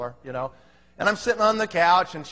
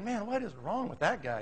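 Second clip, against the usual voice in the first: much softer, puzzled inner voice; private thought; 11 dB quieter than usual. Retold or imitated. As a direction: wonder it under your breath. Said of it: "and I'm thinking".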